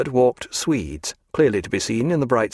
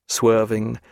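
ordinary delivery, nothing marked

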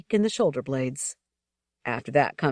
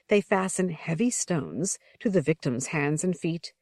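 plain reading voice

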